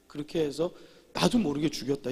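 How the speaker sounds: ordinary talk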